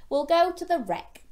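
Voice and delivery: lightly voiced mum announcing a treat; mum offering a treat. Mum answering her hungry child, lightly acted.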